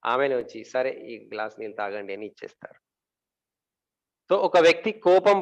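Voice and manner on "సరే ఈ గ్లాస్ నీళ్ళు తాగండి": soft, lower acted offer; hushed, conciliatory wife's voice, lightly acted; calmed-down wife. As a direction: give in quietly, voice dropped. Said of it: "గమ్మున కూర్చుంటే పది నిమిషాల తర్వాత".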